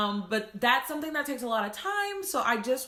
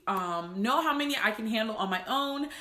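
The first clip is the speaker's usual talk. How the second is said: usual speaking voice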